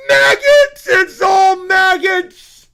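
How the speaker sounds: very high, loud, drawn-out wail; horrified shriek. Acted. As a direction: scream it in hammed-up horror. Acted horror at maggots.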